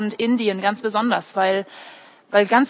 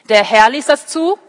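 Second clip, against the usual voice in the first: much louder, higher, indignant repeat of the question; loud disbelief; 3 semitones higher, 9 dB louder than usual. Acted. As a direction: throw out "Der Herr ließ das zu?" as a shocked, loud outcry, rising on "zu". Rereads verse in disbelief.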